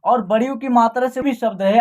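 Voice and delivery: ordinary delivery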